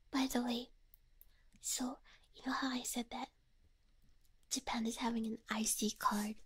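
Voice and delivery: soft close whisper. Real, not acted. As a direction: whisper it like a secret. Whispers throughout.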